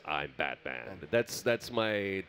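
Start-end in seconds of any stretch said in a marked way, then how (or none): 0.1-1.0 low gravelly growl, softer; Batman voice. Shown as a demonstration. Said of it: "my Batman is me doing my best Kevin Conroy impersonation".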